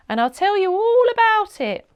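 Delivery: put-on high, loud, cheerful old lady voice; old lady calling goodbye to customers; croaky old lady. A narrator's reading. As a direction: call out goodbye like a chirpy old shopkeeper. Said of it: "with a croaky voice".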